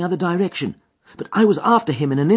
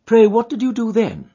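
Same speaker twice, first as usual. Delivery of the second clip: plain reading voice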